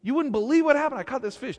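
high-pitched, eager telling; excited news. Acted. Fisherman tells his wife about his catch, high and excited. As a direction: burst out with amazing news.

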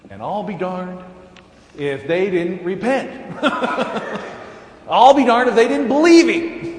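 amused disbelief. Real, not acted.

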